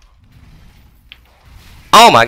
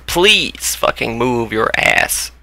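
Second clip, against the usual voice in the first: ordinary talk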